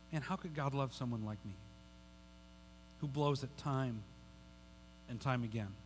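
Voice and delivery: low, soft, halting self-doubt; retold quiet shame, turned inward; dejected self-reproach. Voices own shamed self-talk.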